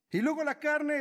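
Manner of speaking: usual speaking voice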